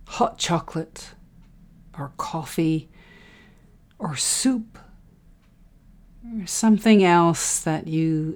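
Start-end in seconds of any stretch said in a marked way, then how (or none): 4.0-4.8 breathy whispered aside. Real, not acted. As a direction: drop to a whisper.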